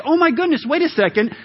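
usual speaking voice

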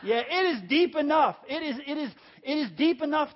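loud rousing call and answer. Shown as a demonstration. Pastor repeats question to stir congregation, loud and fervent. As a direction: lift the room with it. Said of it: "let's try it again".

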